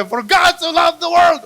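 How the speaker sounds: shouted high and slow, like a furious outburst; mock angry verse. To the congregation, showing anger turned into scripture, a mock furious shout. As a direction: yell the Bible verse as if in a rage. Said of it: "parang galit ka".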